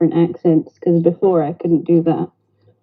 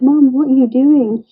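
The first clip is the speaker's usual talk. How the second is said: higher-pitched imitation of pestering kids; children's voices; 7 semitones higher than usual. Mother imitates her children seeking her out, higher kid voice. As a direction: mimic a child calling out to Mom, pitched up. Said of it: "they're like".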